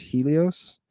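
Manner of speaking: Helios as "HEE-lee-os"; English pronunciation. Shown as a demonstration. Teacher giving the class the English form, Helios as "HEE-lee-os". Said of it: "in English".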